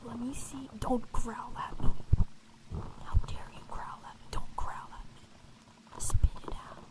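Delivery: whispered scolding of a pet dog; stern playful whisper, close-mic; whispered asmr role play. Acted. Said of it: "the pet dog A S M R roleplay".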